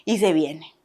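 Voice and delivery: usual speaking voice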